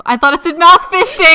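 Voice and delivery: high, loud laughing exclamation; laughing shout. Real, not acted. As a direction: blurt it out, cracking up. Laughing outburst over misreading.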